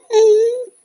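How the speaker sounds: tearful whimper. Acted. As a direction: whimper like a crying child. Boy whimpers.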